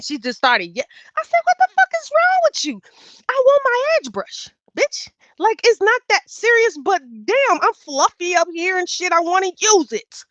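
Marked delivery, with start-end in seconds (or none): 1.4-10.2 much higher, louder exasperated yell; yelling at the roommate over the edge brush; retold yelling. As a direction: scream it at her, fed up.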